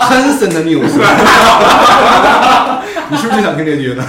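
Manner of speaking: 笑着说. Real, not acted. 笑着说出赞助商名字.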